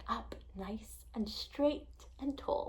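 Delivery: slow, soft whisper; awed whisper. A narrator's reading. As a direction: breathe it out in awe. Awestruck as she describes the fin.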